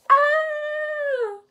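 high, drawn-out story scream; long high "aah" of mock fright; frightened scream. A narrator's reading. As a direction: scream in pretend fear. Voicing bear's big fears.

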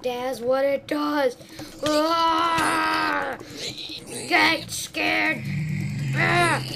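loud high battle yells; excited yelling. Real, not acted. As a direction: yell at the mobs. Yelling at mobs in fight.

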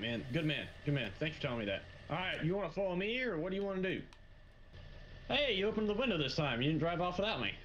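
ordinary talk

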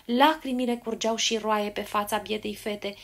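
ordinary delivery, nothing marked

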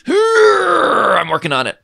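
slow, high strained call; straining shout. Real, not acted. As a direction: push the words out with effort. Straining at handle.

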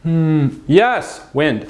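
ordinary delivery

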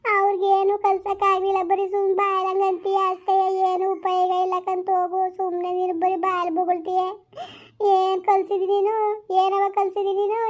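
high, drawn-out weeping complaint; wailing daughter. Acted. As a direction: wail it out like a ruined young wife crying to her mother. Daughter cries, blames mother.

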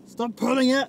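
high, loud snap; real scolding shout. Real, not acted. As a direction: shout it out in irritation. Scolding someone mid-recording.

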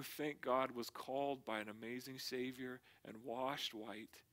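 quietly humble and thankful, voice brought down; heartfelt testimony. Real, not acted. Preacher shares great-grandma's testimony as his own, quietly sincere. Personal testimony of being saved by grace.

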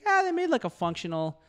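high relieved sigh of a parent. Retold or imitated. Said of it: "their parents are just like".

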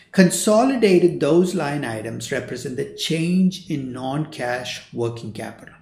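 "those line items represent the change in non-cash working capital" drawn out slowly; slow and deliberate. Shown as a demonstration. Lecturer slowly spells out a key definition for students. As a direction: lay the definition out slowly, word by word. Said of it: "Let me repeat that again".

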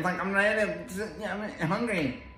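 high pitched mock whine of a hangry girl; whiny hangry friend. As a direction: imitate a hungry friend begging to eat. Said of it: "this is exactly how she sounded".

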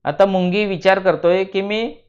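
ordinary delivery, nothing marked

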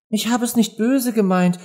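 high, pleading little excuse; apologetic. A narrator's reading. Poor Alice excuses herself to the offended mouse, apologetic. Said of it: "entschuldigte sich die arme Alice".

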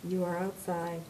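a gentle woman speaking from above and behind; calm facility voice. Acted. The building's voice telling Mary where she is, calm and soft.

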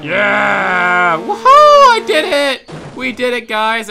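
very high, loud victory yell; triumphant shout. Real, not acted. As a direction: scream with joy at winning. Celebrating finishing the course.

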